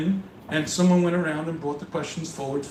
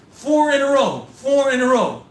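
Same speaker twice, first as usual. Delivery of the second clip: higher and louder, hammering four in a row; incredulous exclamation; 7 semitones higher, 6 dB louder than usual. Real, not acted. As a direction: exclaim it in mock disbelief to the crowd. Exasperated at fourth same question.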